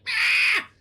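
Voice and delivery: high loud mock scream; piercingly high imitation scream; imitated death shriek. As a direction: shriek like a dying creature. Imitates the creature being killed.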